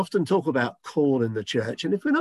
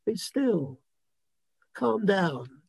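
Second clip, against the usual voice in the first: calm him down gently; hushed soothing words of peace; gentle reassurance. Retold or imitated. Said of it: "God saying to you".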